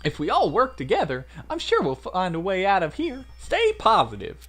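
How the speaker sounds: high, loud Southern encouragement; rally the group in a Southern accent; Southern girl. Acted. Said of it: "why I made her Southern".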